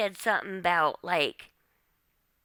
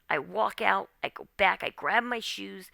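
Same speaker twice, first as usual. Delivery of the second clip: ordinary delivery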